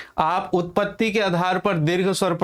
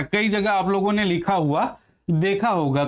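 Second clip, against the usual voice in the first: ordinary talk, nothing marked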